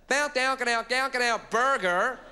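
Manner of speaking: high, loud American-sounding gibberish; twangy American babble as a French listener hears it; mock American. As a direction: parody an American tourist in nonsense sounds. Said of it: "This American man came up to me".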